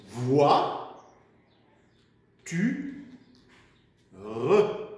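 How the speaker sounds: "voix, tu, re" slowly split with long pauses; syllable by syllable. Shown as a demonstration. Teacher helping children spell, "voix, tu, re" syllable by syllable. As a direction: dictate "voix, tu, re" as three separate syllables, sounding the final "re". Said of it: "fait des petites syllabes".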